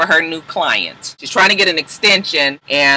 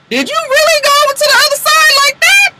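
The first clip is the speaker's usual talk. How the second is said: high, loud squeal of disbelief; incredulous mock shriek; 5 dB louder than usual. Annalise reacting to Bonnie switching sides, hammed-up incredulous shriek. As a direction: play up the outrage in a piercing high pitch.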